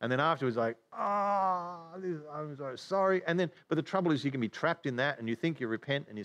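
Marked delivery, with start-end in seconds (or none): 0.9-3.2 drawn-out regretful groan into apology; mock remorse. Preacher imitating someone sorry afterwards, groaning remorse. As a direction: act out a sorry sinner's moan.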